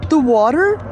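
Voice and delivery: high, loud question with rising end; disbelieving question. Acted. Manuel questions what he just said, incredulous.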